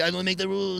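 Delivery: mock-pompous French accent; lightly put-on French accent. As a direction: shrug it off as a caricature Frenchman. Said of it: "French people are weird as shit".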